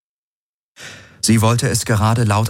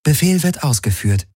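higher-pitched computer confirmation; synthetic announcer, pitched up; artificial ship voice; 8 semitones higher than usual. A narrator's reading. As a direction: acknowledge as the ship's AI. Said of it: "die künstliche Stimme der Vago".

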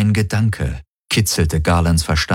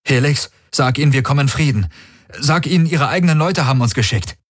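urgent, higher-pitched plea; suppressed panic; 7 semitones higher than usual. A narrator's reading. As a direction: fight down rising panic as you speak. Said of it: "seine aufsteigende Panik niederzukämpfen".

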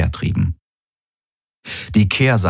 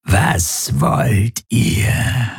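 very slow, eerie monster whisper; sinister creature voice; 6 semitones higher, much slower than usual. A narrator's reading. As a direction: drag it out like a ghoul. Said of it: "verengten sich zu bösen Schlitzen".

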